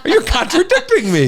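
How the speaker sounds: real laughter through teasing words; laughing tease. Real, not acted. Wife playfully brushing off husband's correction, laughing.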